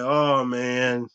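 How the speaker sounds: low, soft deflated groan; a mock-mopey sigh over hot wives; droopy sigh. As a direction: sag like a sad sack. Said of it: "I'm gonna be like".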